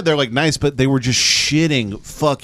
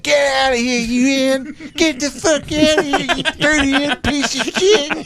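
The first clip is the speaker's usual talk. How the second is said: mock furious yell. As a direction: imitate a grown man yelling, pitched way up. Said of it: "they'd yell at him".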